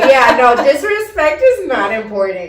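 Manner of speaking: loud, amused protest through laughter; laughing correction. Real, not acted. Co-host corrects a slip about respect, laughing loudly. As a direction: blurt it out while cracking up. Both crack up over a slip.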